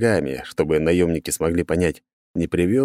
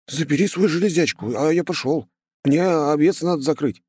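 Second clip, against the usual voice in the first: lightly voiced simple village lad; a hint of a rustic boy; young shepherd boy; 9 semitones higher than usual. A narrator's reading.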